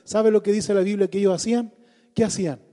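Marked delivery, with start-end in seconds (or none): 0.0-1.3 impassioned loud sermon delivery; preaching at full force. Acted. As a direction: preach it hard and fervent. (predicación apasionada a toda voz)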